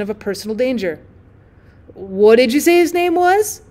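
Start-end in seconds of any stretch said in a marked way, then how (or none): 1.9-3.6 slow, higher, uneasy; 1 nervous question. Acted. Drummer sensing personal danger, slow and uneasy. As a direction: ask with rising unease.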